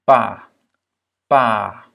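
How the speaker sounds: pas loud, with a long A. Shown as a demonstration. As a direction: punch out pas, holding the A. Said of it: "C'est aussi un A long". (« pas » fort, avec un A long)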